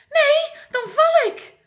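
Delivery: very high, loud frightened protest; scared wail. Acted. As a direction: plead in fear of falling. Giraf scared of falling.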